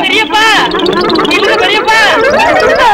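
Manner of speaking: high, urgent call after an uncle. Acted. Nephew begs his uncle to stop, calling out. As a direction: call out, pitch climbing with each cry.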